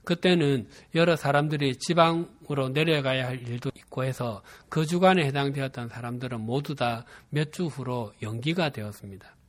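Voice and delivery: usual speaking voice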